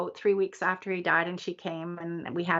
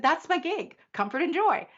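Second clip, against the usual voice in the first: excited; 9 semitones higher than usual. Retold or imitated. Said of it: "I said".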